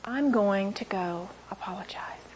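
soft, whispered reply; gentle whisper. Retold or imitated. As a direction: say it softly, almost a whisper. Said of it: "Jesus turned to me and said".

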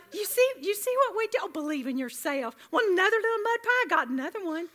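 high, loud sugary sales pitch; mock pushy seller. Offering Holly a mud pie, high and gushing. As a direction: push the mud pie sweetly.

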